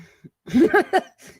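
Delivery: sudden high laugh; high burst of laughter. Real, not acted. Reacting to the clip.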